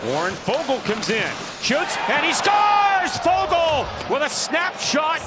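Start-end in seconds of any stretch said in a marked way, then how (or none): 1.6-5.2 long goal yell. Real, not acted. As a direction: stretch out scores. Goal call by play-by-play announcer.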